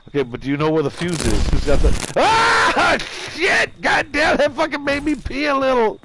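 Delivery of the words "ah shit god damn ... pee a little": startled scream. Real, not acted. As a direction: cry out at a jump scare. Jump scare in the game.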